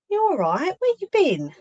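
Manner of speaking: very high, sing-song greeting; high-pitched fussing. Real, not acted. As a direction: coo it high as if to a pet. Fussing over someone in high voice.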